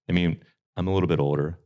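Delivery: usual speaking voice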